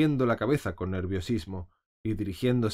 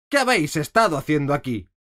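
loud, higher accusing question; angry demand; 6 semitones higher, 7 dB louder than usual. A narrator's reading. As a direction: demand an answer like an angry queen. Queen interrogates gardeners. (pregunta acusadora, alta y fuerte)

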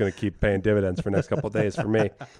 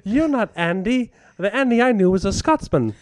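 mock dramatic accusation; 8 semitones higher than usual. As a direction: accuse him like a soap heroine.

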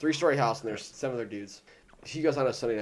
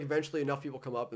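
ordinary delivery

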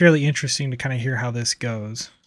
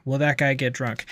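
usual speaking voice

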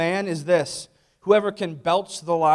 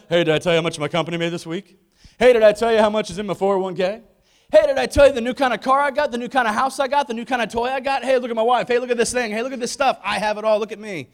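fast, louder boastful show-off, higher pitched; bragging rich guy; 5 semitones higher, 5 dB louder, faster than usual. Retold or imitated. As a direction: rattle off your wins like a smug braggart. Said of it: "He's the guy that's always like".

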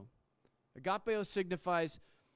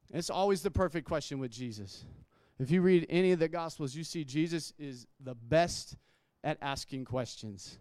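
ordinary talk

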